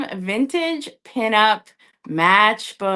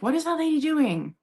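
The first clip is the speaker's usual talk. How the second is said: lightly voiced curious onlooker, higher pitch; mock a viewer wondering, pitched up a little; playful imitation of a puzzled watcher; 6 semitones higher than usual. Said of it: "So when you are like".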